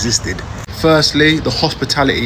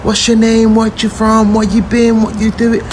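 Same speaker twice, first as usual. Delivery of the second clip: higher, eager imitation of welcoming locals; friendly Americans; 7 semitones higher than usual. Locals welcoming a Brit, imitated eager and higher. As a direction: fire off the questions like a keen host. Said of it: "they're like".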